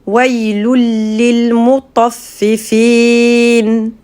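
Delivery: récitation psalmodiée, lente et tenue; réciter le verset en tajwid, voyelles allongées; récitation coranique. Shown as a demonstration. (cantillation lente du verset)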